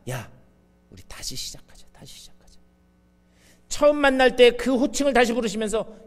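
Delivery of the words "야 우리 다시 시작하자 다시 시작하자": hushed, tender invitation to begin again; gentle whisper. Acted. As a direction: whisper it like a comforting promise. Jesus gently restoring Peter. (다정하게 속삭이듯)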